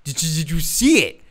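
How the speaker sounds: lightly imitated drunk in awe; slowed-down drunken wonder, mildly acted; awestruck drunk. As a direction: stretch it out like an amazed drunk. Retelling drunk man's awestruck answer.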